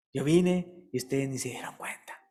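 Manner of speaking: hushed sorrowful whisper; Jesus mourning over Jerusalem, whispered; whispered lament. Acted. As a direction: breathe it like a grieving secret.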